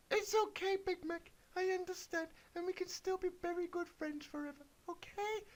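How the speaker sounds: very high, sweet little pony voice; squeaky Twilight. Acted. Twilight answers the wizard in a very high, happy squeak. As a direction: chirp it like a toy pony. Twilight reassures Big Mac.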